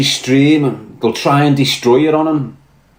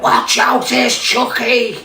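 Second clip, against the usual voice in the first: retold warning shout; 10 semitones higher, much slower than usual. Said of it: "Billy's just went".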